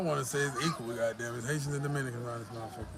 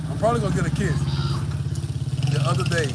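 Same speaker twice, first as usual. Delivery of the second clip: higher and much louder, pumped up; excited boast; 5 semitones higher, 11 dB louder, faster than usual. Real, not acted. To his viewers about winning a kiss, loud excited boast. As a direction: shout it out like a proud win.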